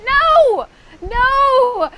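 high, loud, drawn-out no; emphatic refusal. Real, not acted. DJ rejecting the idea there's no room, emphatic cry. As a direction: cry out no in firm protest.

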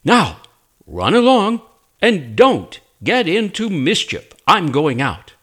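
a mother shooing her little ones out; mother rabbit. Acted. Mother rabbit addresses her children.

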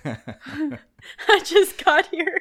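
words spilling out through a laugh. Real, not acted. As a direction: laugh while you say it. Laughing at own confusion.

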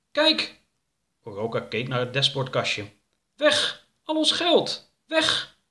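shrill cartoon alarm at the empty glovebox; alarmed cry. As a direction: shout it like the money just vanished. Wubbel discovers money is gone.

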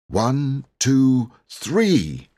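high, loud count building; counting up to a yell. Acted. Boy counting the children in to a yell. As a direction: count them in to scream.

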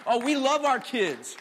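normal talking voice

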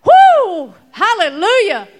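a big, high, loud cry of joy; excited whoop. Acted. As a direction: whoop like the elders jumping up in praise. Elders leap up rejoicing.

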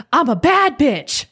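high, proud burst of self-belief; lit-up excitement. Retold or imitated. Imitating a client discovering who she is, excited. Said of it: "how your voice changed".

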